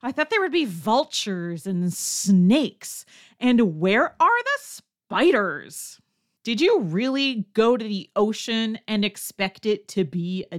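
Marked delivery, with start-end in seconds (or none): 0.0-6.0 mock confusion at the beach; baffled tourist. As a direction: play a puzzled beachgoer looking for desert creatures. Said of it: "you hear someone ask".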